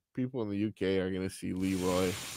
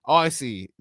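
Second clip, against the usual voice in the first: ordinary talk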